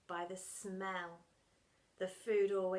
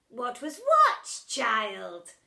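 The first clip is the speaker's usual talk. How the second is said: 1 mock innocent; 2 semitones higher, 12 dB louder, much slower than usual. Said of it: "mock innocently".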